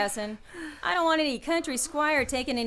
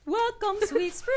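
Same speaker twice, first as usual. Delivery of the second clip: mock stage performance; 8 semitones higher than usual. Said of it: "I'll give you a special performance".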